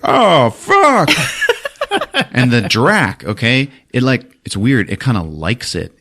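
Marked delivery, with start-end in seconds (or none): 0.0-1.1 high, loud cry of dismay; hammed-up panic at the storm; mock alarmed yell. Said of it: "And Dennis is like".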